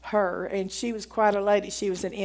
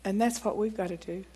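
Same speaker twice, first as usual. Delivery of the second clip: ordinary talk, nothing marked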